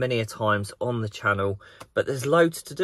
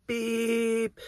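single high mock horn toot; imitated horn beep; 11 semitones higher, 4 dB quieter than usual. Vlogger mimics his own horn toot at the woman, high beep. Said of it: "I bleeped on the horn".

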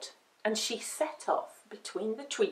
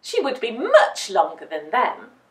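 ordinary delivery

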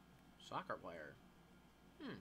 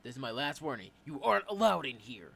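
stern guard; 13 dB louder than usual. Acted. Guard gives final warning.

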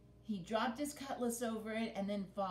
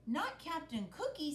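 excited protest; 7 semitones higher than usual. Acted. Boy protests saving his favourite.

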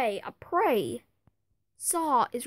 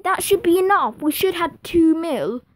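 loud and higher, thrilled; excited shout; 4 semitones higher, 14 dB louder than usual. Real, not acted. Gamer celebrating money left in the bank, excited shout. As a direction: call it out in triumph. Celebrating accepted transfer fee.

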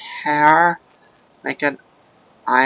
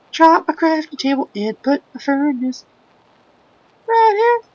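silly high voice; 13 semitones higher than usual. Pretend. Gamer showing off his practiced silly high voice. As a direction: squeak it out like a cartoon critter. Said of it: "It's a really cool voice".

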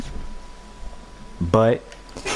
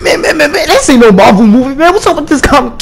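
loud, pumped-up hype-man chant, higher and louder; hype intro shout; 7 semitones higher, 11 dB louder than usual. Acted. Opening his video to the viewers, a loud hyped-up chant. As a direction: fire up the crowd with every man.

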